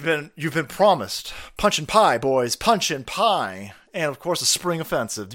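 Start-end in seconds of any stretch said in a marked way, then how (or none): none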